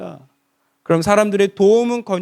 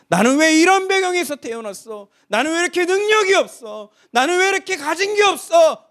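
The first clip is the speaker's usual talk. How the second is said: high, whining lament; self-pitying complaint; 9 semitones higher, 3 dB louder than usual. Retold or imitated. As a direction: grumble about your lack. 자기 연민의 독백 흉내. (신세를 한탄하는 푸념)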